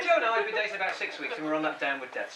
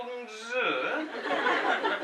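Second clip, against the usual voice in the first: ordinary delivery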